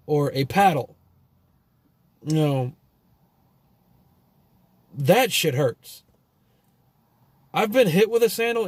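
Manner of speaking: ordinary talk, nothing marked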